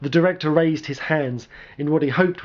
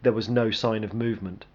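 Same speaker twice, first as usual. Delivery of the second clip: ordinary delivery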